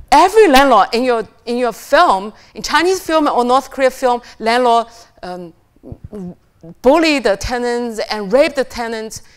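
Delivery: daughter's protest. Retold or imitated. Daughter lecturing her mother about film landlords, loud fast rant. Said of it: "And she says".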